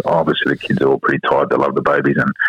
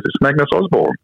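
put-on high, proud little-boy announcement; 10 semitones higher than usual. Dad imitating his son proudly giving his full name, high-pitched. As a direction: say your full name like a proud four-year-old. Four-year-old voiced in story.

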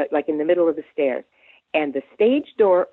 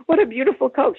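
gushing admiration; 9 semitones higher, faster than usual. Retold or imitated. Admiring [name]'s mink.

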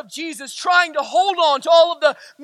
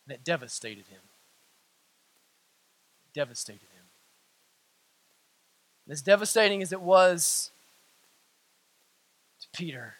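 very low, very soft, grave delivery; hushed preaching; 11 semitones lower, 9 dB quieter than usual. Real, not acted. Preacher on how devastated Peter was, hushed preaching. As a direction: drop almost to nothing, with long pauses.

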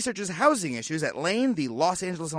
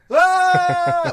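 loud, high, drawn-out "aah"; mock falling scream; 11 semitones higher, 8 dB louder than usual. Host imitating the expert's pretend fall, mock scream. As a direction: yell like a hammed-up plunge from a window. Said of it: "pretend to fall and go".

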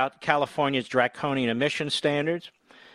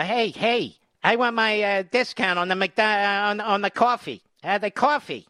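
a higher-pitched, lightly voiced customer demanding a discount; pushy customer; 7 semitones higher than usual. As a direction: play the fumbling guy insisting on his coffee discount. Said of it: "I'm going".

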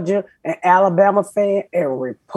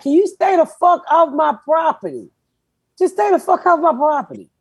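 higher, exasperated demand; mock annoyed demand; 7 semitones higher than usual. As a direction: act out telling them to get off your land. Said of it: "And I'm like".

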